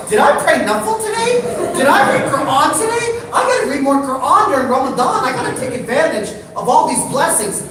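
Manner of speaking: high, loud, frantic last-minute repenting; praying in a comic panic; mock panic. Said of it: "So I'm freaking out".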